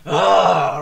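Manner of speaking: sudden loud cracked "Ah"; mock voice crack yelp. Telling friend about his cracking voice, loud cracked yelp. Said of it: "when I go".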